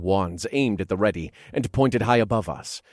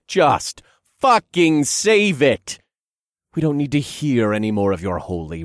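angry shouting; 6 semitones higher, 6 dB louder than usual. Acted. Cole yells insults upward.

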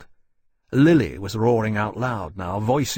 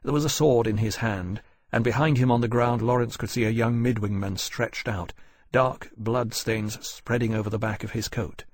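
ordinary delivery

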